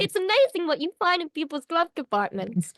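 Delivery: mild Harley-style New York twang; New York accent. Shown as a demonstration. Guest keeps the New York accent going in the demo. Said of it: "where you talk like this".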